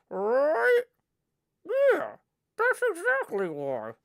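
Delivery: high ventriloquist puppet voice. Acted. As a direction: talk for the puppet through still lips. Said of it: "He'd be more like".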